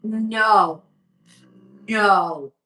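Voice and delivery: loud, firm no to the dog; firm commands. Real, not acted. To her barking dog, firm loud commands. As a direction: cut the dog off sharply, one word at a time.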